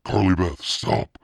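loud, high plea; pleading shout. A narrator's reading. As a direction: beg her loudly to stop. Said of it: "Sabrina pleaded".

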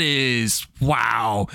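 slow, stretched amazed wow; drawn-out wow. Real, not acted. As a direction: let the wow hang in disbelief. Amazed reaction to the game.

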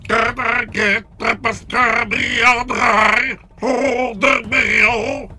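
loud, high, slow robotic chant; Dalek. Shown as a demonstration. Coach on a speed test, strident robot impression. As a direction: shout it syllable by syllable like a machine. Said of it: "A Dalek".

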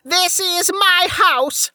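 put-on very high, drawn-out old woman's scream. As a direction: scream it slowly, full of possessive rage. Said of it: "she screamed at him".

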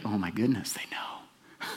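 unvoiced fearful whisper; panicked hushed whisper; paranoid whisper. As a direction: whisper it as if caught out. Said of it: "And you're like".

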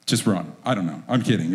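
ordinary delivery

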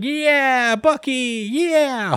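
excited cheer. Retold or imitated. Kids cheering as the teacher pardons Bucky. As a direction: cheer like a whole class celebrating. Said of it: "everybody's like".

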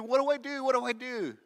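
loud, high, frantic repeated question; shocked panic. Retold or imitated. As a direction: blurt it out twice in alarm. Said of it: "the guy was so shocked".

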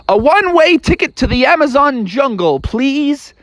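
high-pitched, polite frog voice; Froggy at the counter. Acted. Froggy orders at airport counter.

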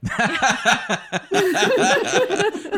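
long, high-pitched laughing fit; real high, drawn-out laughter; big laugh. Real, not acted. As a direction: burst out laughing and keep it going. Laughing at wife's hesitant answer.

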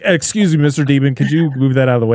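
loud, exaggerated polite request to a demon; mock-polite plea. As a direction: ask the demon nicely, hammed up.

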